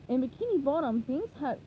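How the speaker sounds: usual speaking voice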